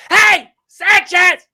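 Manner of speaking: high, drawn-out shout; little girl's holler. Retold or imitated. Little girl calling to a player from the baseline, high shout. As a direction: holler like a kid at a ballgame. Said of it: "she goes".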